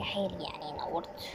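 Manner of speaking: sarcastic whisper; whispered aside. Acted. Friend mutters sarcasm at the latecomer, whispered. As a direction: breathe it as a snide aside.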